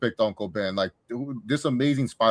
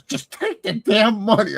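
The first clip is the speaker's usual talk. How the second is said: high, slow, stern aunt voice; Aunt May; 11 semitones higher, 6 dB louder, slower than usual. Retold or imitated. Aunt forcing Peter to take money, stern and slow. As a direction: put on a much higher voice and press each word slowly. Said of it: "she goes".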